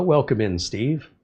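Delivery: ordinary delivery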